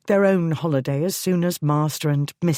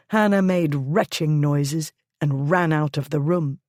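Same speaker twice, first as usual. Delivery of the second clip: plain reading voice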